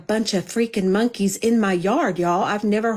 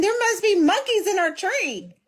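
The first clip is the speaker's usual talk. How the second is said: much higher, alarmed mock report; retold alarm; 11 semitones higher than usual. Telling her husband about noises in the yard, high mock alarm. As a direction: blurt it like waking someone with news. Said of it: "I told my husband".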